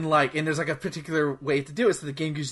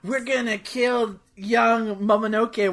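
slow, higher-pitched villain monologue; mock villain cutscene; 6 semitones higher, slower than usual. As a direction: mimic an overdone game villain speech. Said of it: "watch the same".

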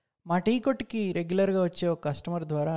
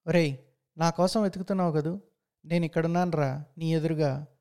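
ordinary delivery

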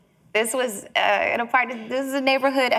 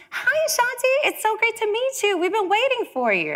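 high, small and sweet, much higher than her own; little tiny voice; 13 semitones higher than usual. Retold or imitated. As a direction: imitate a tiny woman's little voice. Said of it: "she had this little tiny voice".

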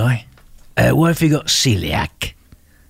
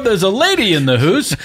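ordinary talk, nothing marked

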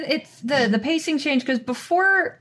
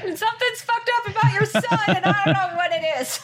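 much higher, laughing through the dad's line; laughing mock dad; 11 semitones higher than usual. Cohost riffs as the dad to his wife, laughing, much higher. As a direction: play the baffled dad through giggles.

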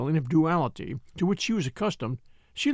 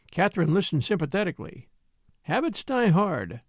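usual speaking voice

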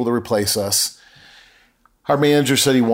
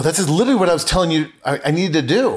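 high, quick incredulous reply; astonished; 6 semitones higher, faster than usual. Retold or imitated. Telling the business partner it was his own idea, astonished. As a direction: blurt it out in raised-pitch disbelief.

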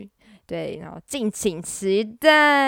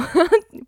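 short rising giggle; 9 semitones higher, 4 dB louder than usual. Real, not acted. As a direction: giggle to yourself. Laughing again at the prank.

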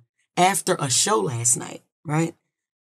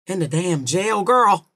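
ordinary delivery

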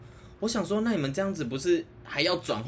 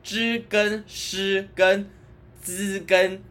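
reading aloud haltingly; 2 semitones higher, much slower than usual. Shown as a demonstration. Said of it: "我只有知跟，知跟诗跟 滋跟 诗 不会分".

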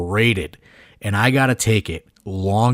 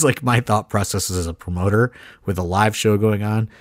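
ordinary talk, nothing marked

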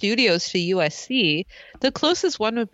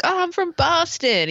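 a light imitated Boston accent, a bit higher; 8 semitones higher than usual. Friendly guard tells Dawson where he is from, in a light Boston accent. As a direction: toss off a mock Boston accent. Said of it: "He says it with an accent".

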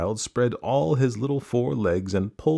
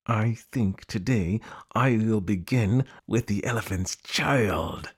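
slow crocodile voice through clenched teeth; between the teeth; slower than usual. Acted. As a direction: force it out slowly with jaws shut. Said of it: "he said it between his teeth like this".

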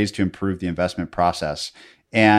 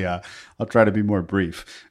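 ordinary delivery

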